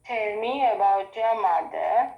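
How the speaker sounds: usual speaking voice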